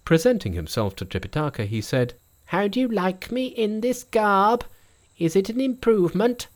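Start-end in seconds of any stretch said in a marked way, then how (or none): 2.5-6.5 high, bright monkey king voice. A narrator's reading.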